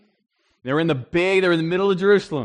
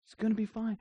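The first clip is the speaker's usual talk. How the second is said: hushed, higher "just trust God"; soft reassurance; 6 semitones higher, 8 dB quieter than usual. Retold or imitated. Friends telling each other to trust God, hushed. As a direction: coax them gently, almost a whisper. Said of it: "where we're like".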